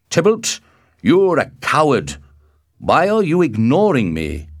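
loud, high-pitched taunt; crying out. A narrator's reading. Mercutio challenges Tybalt to fight, crying out loudly. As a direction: cry out to goad your rival. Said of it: "Mercutio cried".

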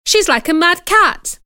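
put-on high, loud girlish cry; excited shout. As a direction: call out in excitement. Girl shouting during struggle.

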